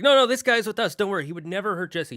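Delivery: high, loud burst that eases into reassurance; urgent intervention. Retold or imitated. Buzz stopping toys from punishing Woody, urgent defence. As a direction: jump in to vouch for a friend.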